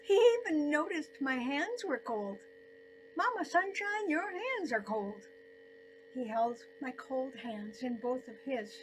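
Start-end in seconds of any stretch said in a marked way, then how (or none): 3.2-5.3 higher, louder, lightly voiced caring son-in-law; a hint of a son-in-law fussing tenderly over her. Son-in-law's caring remark retold.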